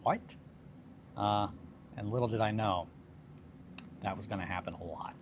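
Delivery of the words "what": shocked. Retold or imitated. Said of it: "And I was like".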